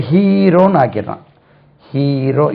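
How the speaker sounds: ஹீரோ with stretched pure "hee"; no glide in ஹீரோ, just long ee; wrong hero. Shown as a demonstration. Teacher shows what to avoid, glideless mispronunciation. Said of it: "இது வேண்டாம்".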